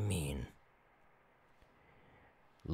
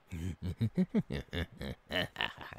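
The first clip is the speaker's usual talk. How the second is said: evil cackle; 4 semitones lower, 7 dB quieter than usual. Acted. Gloats about pillaging.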